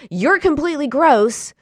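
imitating her easy, loud self-insult; fired-up self-hatred. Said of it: "She was all fired up, full on hating herself".

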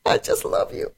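hushed, airy whisper; whispered gush. Real, not acted. Host gushing to guest over his diamonds, whispered. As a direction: drop into an adoring whisper.

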